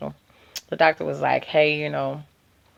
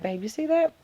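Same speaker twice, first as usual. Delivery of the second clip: hushed amazement; 10 semitones higher, 7 dB quieter than usual. Retold or imitated. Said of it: "I'm like".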